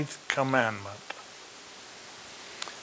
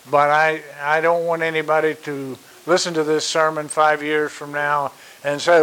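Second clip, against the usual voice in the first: usual speaking voice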